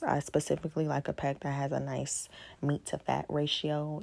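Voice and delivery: normal talking voice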